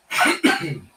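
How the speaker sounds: rough throat-clearing cough; chesty cough. Real, not acted. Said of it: "Fucking can't stop coughing".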